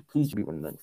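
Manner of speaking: ordinary delivery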